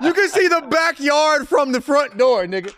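laughing shout. Real, not acted. Laughing exaggerated house story.

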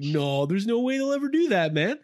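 lightly hammed Canadian disbelief. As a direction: scoff in a mock Canadian voice. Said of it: "I'll just do my Canadian voice".

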